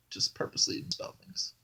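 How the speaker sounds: soft, voiceless mumble; hushed, under-sold joke delivery; unconfident punchline. Real, not acted. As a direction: let the punchline drop away quietly. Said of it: "You gotta read the punchlines with confidence".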